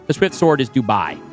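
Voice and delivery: ordinary talk, nothing marked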